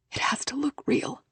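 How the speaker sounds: urgent soft whisper. Acted. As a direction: whisper it right into her ear. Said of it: "Lucy whispers in her ear".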